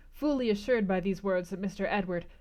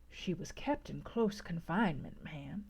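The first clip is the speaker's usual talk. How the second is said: soft confiding whisper, much softer; speaking low; 3 semitones lower, 8 dB quieter than usual. A narrator's reading. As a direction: keep the secret hushed. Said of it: "speaking low".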